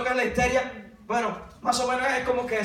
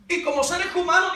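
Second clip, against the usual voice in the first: ordinary delivery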